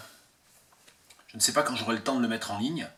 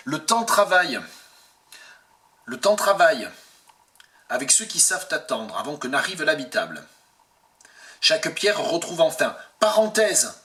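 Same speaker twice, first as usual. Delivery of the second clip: projected poetry reading, starting high and loud, settling by the end; poem recitation; 4 semitones higher, 5 dB louder than usual. Acted. Translator rereads the poem before translating it, projected recitation. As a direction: declaim each verse, pausing between phrases.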